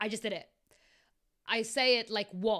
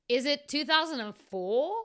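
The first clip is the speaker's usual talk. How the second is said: higher, louder incredulous question; surprised; 5 semitones higher, 6 dB louder than usual. A narrator's reading. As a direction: read it as a surprised query. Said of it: "I asked, surprised".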